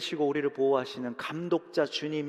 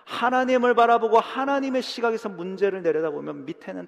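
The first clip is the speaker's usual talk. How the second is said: preaching climax; 6 semitones higher, 7 dB louder than usual. Acted.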